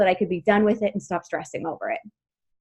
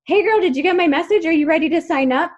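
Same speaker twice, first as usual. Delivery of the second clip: pushy salesy mock; 6 semitones higher, 4 dB louder, faster than usual. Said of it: "instead of being like".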